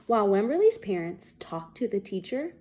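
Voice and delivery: usual speaking voice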